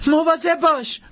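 high-pitched woman's shriek; screamed warning. Acted. Imabelle warning Jackson of danger, high scream. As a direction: cry out a sudden warning up high. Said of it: "ایمابل جیغ کشید".

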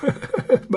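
short breathy chuckle, voiced high; a quick airy old man's chuckle; old man's laugh. A narrator's reading. Old seller laughing at the buyer, breathy chuckle. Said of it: "پیرمرد خندید".

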